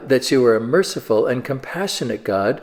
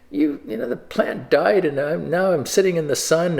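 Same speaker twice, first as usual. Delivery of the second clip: put-on whiny petulant child; 5 semitones higher than usual. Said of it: "a petulant child. He's like".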